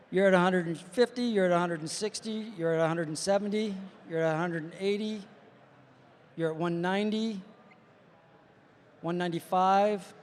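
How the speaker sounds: imitated readout, stretching out the last number; mock speed readout. Passenger reading speeds to the driver, mock speed readout. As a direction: count off rising figures with long, suspenseful gaps.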